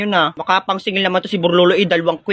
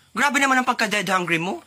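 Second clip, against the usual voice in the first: ordinary delivery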